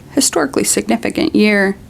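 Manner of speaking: ordinary delivery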